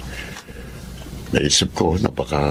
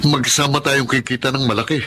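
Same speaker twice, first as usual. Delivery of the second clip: higher-pitched, stumbling reply; hesitant agreement; 7 semitones higher than usual. Acted. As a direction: fumble into agreeing, then hold back. Caught off guard, agrees with doubt. (nag-aalangang pagpayag)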